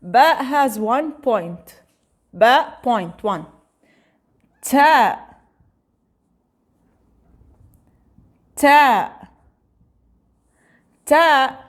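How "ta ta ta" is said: Ta said loud for repeating; teacher drilling Ta; letter name Ta. Shown as a demonstration. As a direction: model Ta for the class. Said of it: "To be more easier to".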